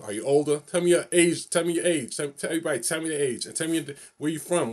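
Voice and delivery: ordinary delivery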